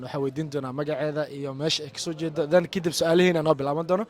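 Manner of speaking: ordinary delivery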